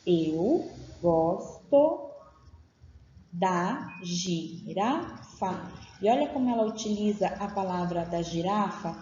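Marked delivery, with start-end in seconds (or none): 0.0-5.7 slowly drawn out, word by word; very slow dictation. Shown as a demonstration. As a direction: dictate Eu gosto da girafa very slowly. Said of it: "E olhem só a frase".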